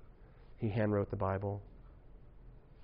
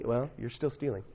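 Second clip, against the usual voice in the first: usual speaking voice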